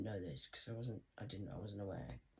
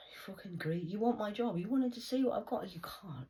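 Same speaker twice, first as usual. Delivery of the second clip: shouted much higher and louder at rivals; furious rant; 10 semitones higher, 7 dB louder than usual. Real, not acted. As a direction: yell it at the people who want your job. Ranting at imagined rivals.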